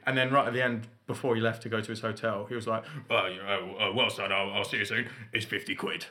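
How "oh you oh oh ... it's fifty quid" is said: lightly voiced gruff Cockney father; a hint of an awkward Cockney dad, slightly lowered; hardened Cockney dad. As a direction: imitate a reticent Cockney dad. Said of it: "like, hardened Cockney voice".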